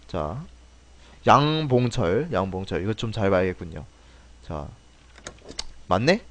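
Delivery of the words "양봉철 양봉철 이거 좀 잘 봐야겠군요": ordinary delivery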